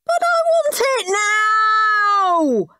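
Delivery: very high, long drawn-out howl; tantrum howl. A narrator's reading. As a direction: wail it like a child demanding his way. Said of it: "howled Henry".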